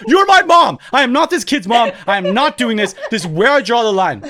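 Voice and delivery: loud, high outburst, easing slightly; indignant protest. Retold or imitated. Son refusing his mom's orders, indignant protest. Retold argument with mother.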